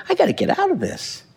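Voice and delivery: lightly voiced resolve; breaking free. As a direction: leave the family mess behind. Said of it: "and say".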